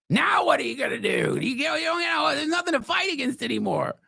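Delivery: mocking conservatives with nothing left to fight; high mocking taunt.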